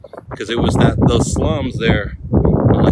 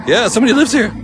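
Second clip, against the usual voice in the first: surprised; 12 semitones higher, 6 dB louder, faster than usual. Real, not acted. Realizes castle is a home.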